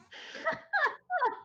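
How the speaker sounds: high gloating overlord laugh; booming villain laugh. Acted. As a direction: cackle down at the prisoners. Overseer mocking escape attempts.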